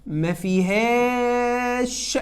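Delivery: ما فيهاش drawn out very slowly; slow teacher emphasis; slow, stretched. Shown as a demonstration. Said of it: "ركز معايا بقى".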